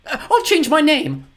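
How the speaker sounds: very high, loud frantic begging; desperate. Acted. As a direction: bargain wildly for your life. Woman bargains for her life.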